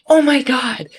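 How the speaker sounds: high, loud retold shock; shocked exclamation. Falconer reliving her bird hitting a man's face, shocked exclamation.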